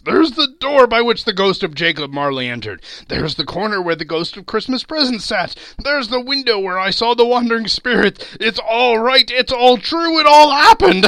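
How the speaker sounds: high, loud, building to ecstatic; mounting jubilant disbelief; excited crying out. A narrator's reading. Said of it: "cried Scrooge".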